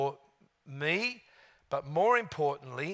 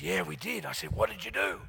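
lightly voiced Australian accent like Steve Irwin; talking like Steve; 6 dB quieter than usual. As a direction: slip into a mild Aussie twang. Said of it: "We're both talking like Steve".

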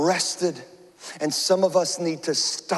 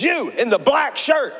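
officer calling out; 7 semitones higher, 3 dB louder than usual. Retold or imitated. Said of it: "she says".

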